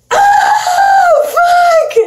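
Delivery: high shrieking yell of pain; pained scream. Retold or imitated. Friend retells ripping her nail on a fence, screaming. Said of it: "screaming in pain".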